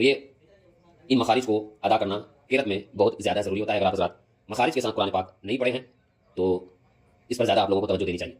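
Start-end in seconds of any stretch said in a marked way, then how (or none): none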